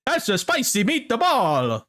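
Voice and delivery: hammed-up, slow cartoon Italian with a high lift; mock the Italian cliché, slow and high. Shown as a demonstration. Friend mocking the cliché Italian accent, hammed-up. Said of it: "this is if I try to do an Italian".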